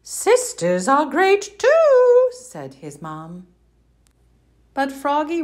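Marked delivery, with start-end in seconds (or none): 0.0-2.3 much higher, louder sing-song mother; sunny mother frog greeting; cheery mom voice. Acted. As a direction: greet him like a bright cartoon mom.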